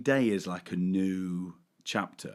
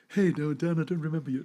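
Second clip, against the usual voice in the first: higher-pitched star turning down a fan; mimicked brush-off; 8 semitones higher, faster than usual. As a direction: put on a voice for an awkward refusal. Voices the star's brush-off.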